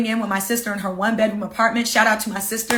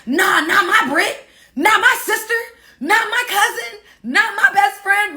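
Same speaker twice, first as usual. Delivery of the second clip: much higher, laughing mock protest; protective loved ones; 10 semitones higher, 3 dB louder than usual. Friends and family defend their Brit, laughing protest. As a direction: voice friends refusing to let her be hurt.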